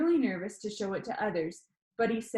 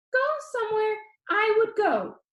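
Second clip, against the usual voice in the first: high, bright-voiced video host; upbeat YouTuber; 13 semitones higher, 5 dB louder than usual. A narrator's reading. YouTuber Danny asks the viewer, upbeat and high. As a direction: pitch it up like an eager vlogger.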